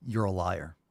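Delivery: ordinary talk, nothing marked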